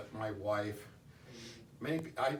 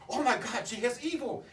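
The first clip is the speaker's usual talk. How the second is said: much higher and louder, frantic retold warning; aunt's panicked warning; 14 semitones higher, 8 dB louder than usual. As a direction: scream at him to get away from her. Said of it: "She says".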